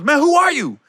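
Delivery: high, mock-angry challenge; confrontational. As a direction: challenge a stranger, imitating an outburst. Comic confronting stranger, acted.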